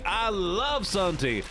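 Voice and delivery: drawn-out dreamy version. Shown as a demonstration. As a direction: savour every word of I love sun tea.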